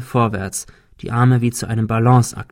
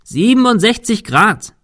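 quick high shout across a distance; calling into wind; 10 semitones higher, 5 dB louder than usual. A narrator's reading. Thomas gives friend the dish angle, calling out. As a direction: call the figure out high and loud. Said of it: "rief Thomas dem Wind entgegen".

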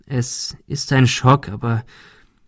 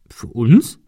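mildly surprised question; astonished; 5 dB louder than usual. A narrator's reading. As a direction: ask it with a touch of astonishment. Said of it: "fragte Ben erstaunt". (leicht erstaunt fragend)

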